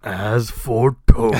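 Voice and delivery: deepest voice. Pretend. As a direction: boom it out like a doom-laden oracle. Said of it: "the deepest voice you can manage".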